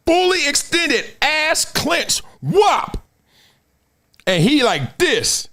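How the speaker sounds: high, drawn-out excited exclamations; awestruck re-enactment. Retold or imitated. Beaten runner describing rival's explosive start, hyped-up awe. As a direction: punch out each body move in amazement.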